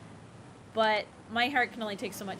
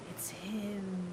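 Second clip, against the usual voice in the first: sharp gasp, then hushed whisper; breathless, starstruck whisper; awestruck whisper; 8 dB quieter than usual. Retold or imitated. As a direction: whisper it like a smitten child spotting an idol. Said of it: "and I said".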